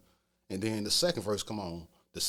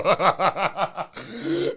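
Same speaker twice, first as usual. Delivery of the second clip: loud high laughter; real laugh; 10 semitones higher, 10 dB louder than usual. Real, not acted. Laughing loudly with the host at the crazy story.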